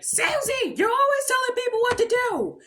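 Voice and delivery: high, loud squealed accusation; mock-outraged, very high voice; losing it. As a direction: squeal the complaint like a furious cartoon kid. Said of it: "Peppa loses it".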